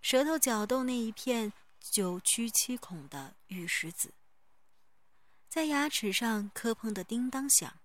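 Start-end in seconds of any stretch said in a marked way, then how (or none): none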